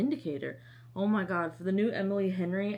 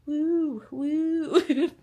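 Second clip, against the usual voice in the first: high, loud woo-woo; playful "woo" sound; 7 semitones higher, 6 dB louder than usual. Retold or imitated. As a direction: sing out two high woos, breaking into a laugh. Imitates the little doll dancing.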